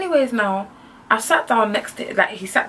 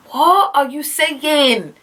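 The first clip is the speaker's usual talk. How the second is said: high, drawn-out incredulous question; higher-pitched, slow exasperation; exasperated disbelief; 6 semitones higher, much slower than usual. Retold or imitated. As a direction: stretch it out in sheer disbelief. Exasperated inner reply to date.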